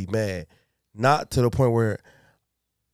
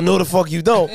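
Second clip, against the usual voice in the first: higher, louder, laughing refusal; laughing mock refusal; 5 semitones higher, 3 dB louder than usual. Father laughing as he refuses to hear daughter's news. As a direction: toss off the refusal while half laughing. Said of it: "in the same man".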